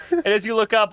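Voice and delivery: ordinary talk, nothing marked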